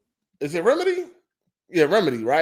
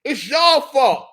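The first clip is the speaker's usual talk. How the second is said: much higher, louder, exasperated rant that slows down; mock outburst; 12 semitones higher, 7 dB louder than usual. Game studios blaming absent buyers, hammed-up angry yell. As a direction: play a fed-up developer yelling at the players. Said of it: "They're like".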